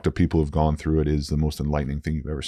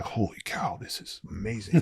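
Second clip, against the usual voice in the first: whispered, higher "Holy cow"; awed whisper; 5 semitones higher, 9 dB quieter than usual. Retold or imitated. Lying in the grass hearing everything, whispered awe. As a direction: whisper it, amazed and high.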